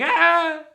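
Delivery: a short fake scream of fleeing soldiers; hammed-up retreating scream; mock scream. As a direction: yelp like you're running scared. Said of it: "run away screaming".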